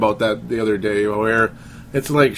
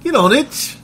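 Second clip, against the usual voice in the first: mock Aussie urging; 4 dB louder than usual. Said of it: "Need some more Aussie accent impersonations".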